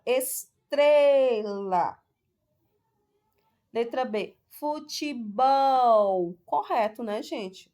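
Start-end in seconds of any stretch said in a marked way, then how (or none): 0.1-2.0 estrela with TRE loud and long; stress on TRE. Shown as a demonstration. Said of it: "Vamos lá pronunciar a palavra". 4.5-6.4 futebol slowly, BOL loud and long; stress on BOL. Shown as a demonstration. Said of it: "O bol é a sílaba tônica".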